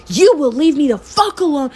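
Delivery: high-pitched reenacted telling-off; retold screaming. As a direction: scream at the ex, held back in volume. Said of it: "I'm like, screaming at him".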